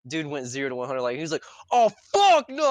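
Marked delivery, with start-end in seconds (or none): 1.7-2.7 high, loud mock scream; screaming rant. Friend imitating the man by the truck going berserk, screaming. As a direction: explode in an imitated outraged yell. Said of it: "just screaming and ranting".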